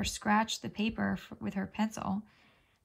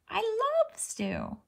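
high, slow swooning; dreamy cat longing; 12 semitones higher, much slower than usual. A narrator's reading. Cat unable to resist stew, high slow swoon. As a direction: melt over the word stew.

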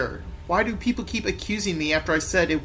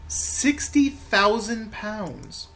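very slow, higher-pitched announcement; stretched-out triumphant reveal; grand reveal; 5 semitones higher, much slower than usual. Acted. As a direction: savour every word of the big figure. Policeman reveals the huge price.

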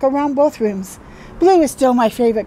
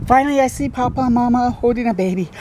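ordinary delivery

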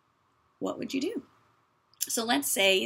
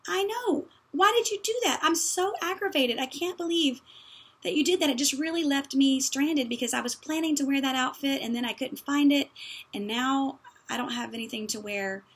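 much higher, louder hammed-up aggravation; aggravated sister; 4 semitones higher, 3 dB louder than usual. Daughter scolding her sister over the lost cardigan, mock aggravated. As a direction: act out an exasperated teen complaining. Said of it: "the other person would say".

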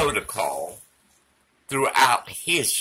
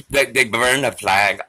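ordinary delivery

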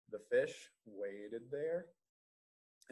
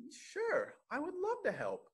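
much higher, bright eager little cat; 5 dB louder than usual. Acted. Cat agreeing to help the dragon, high and eager.

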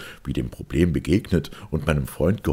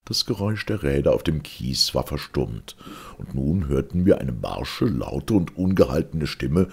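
ordinary delivery, nothing marked

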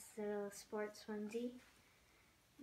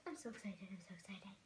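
hushed, low whisper to yourself; excited whisper; 3 semitones lower, 8 dB quieter, faster than usual. Real, not acted. Vlogger to herself while unwrapping the doll, excited whisper. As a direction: whisper it under your breath, barely containing it.

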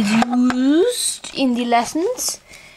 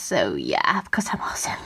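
breathy unvoiced aside; whispered aside; 8 dB quieter than usual. Real, not acted.

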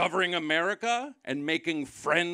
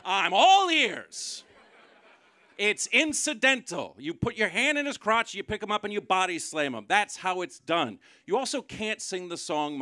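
exasperated rant; 7 semitones higher, 6 dB louder than usual. Real, not acted.

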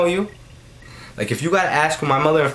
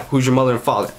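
ordinary delivery

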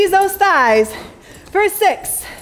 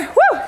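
cheering woo; 11 semitones higher than usual. Real, not acted. Instructor cheers.

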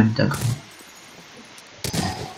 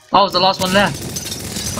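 excited; 7 semitones higher, slower than usual. Real, not acted. Caught, last survivor.

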